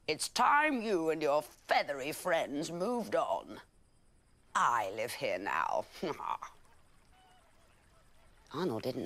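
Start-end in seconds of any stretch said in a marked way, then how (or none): 0.1-6.1 slow, smug crocodile voice; nasty crocodile. Acted. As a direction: menace him slowly like a nasty crocodile. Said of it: "said the crocodile".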